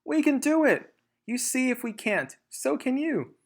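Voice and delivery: high, bright little-fox cheer; rally Dad with eager confidence; small foxes cheering their father on; eager child fox. Acted.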